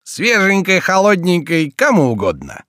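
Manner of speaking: high, nasal vendor's cry; nasal street vendor. Retold or imitated. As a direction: hawk it like a nasal street seller. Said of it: "подражая гнусавому голосу разносчиков".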